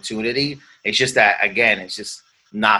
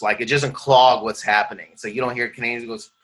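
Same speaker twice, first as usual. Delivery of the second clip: ordinary delivery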